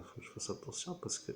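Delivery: soft whisper so the cat can sleep. Real, not acted. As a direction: hush it, don't wake the cat.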